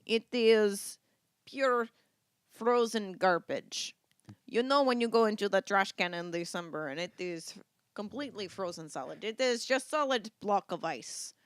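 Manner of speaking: mock Russian accent, deliberate pauses; hammy Russian-accented storytelling. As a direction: put on a thick comic Russian accent. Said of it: "as the Russians say".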